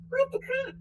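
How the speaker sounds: high, incredulous what the crap; shocked thought. Retold or imitated. Thinking about players without jock straps, high incredulous squeak. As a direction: squeak it in disbelief. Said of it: "And I'm thinking".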